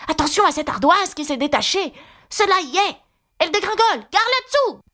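high-pitched urgent warning; cri d'alerte. A narrator's reading. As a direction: call out danger in a rising, high voice. Animals warn of falling slate. (alerte criée d'une voix aiguë)

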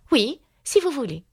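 put-on high-pitched girl's reply; agreeable little girl. A narrator's reading. Alice accepting the unicorn's bargain, agreeable and high.

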